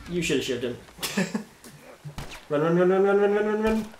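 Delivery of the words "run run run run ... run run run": urgent rising shouts to run; being chased. Real, not acted. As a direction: yell run over and over, higher and louder. Fleeing enemies, urging escape.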